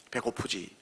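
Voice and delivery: soft, low and gentle; warm tender question. Retold or imitated. Jesus to hungry Peter, soft and tender. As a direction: ask it tenderly, barely above a breath. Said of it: "따뜻한 표현으로".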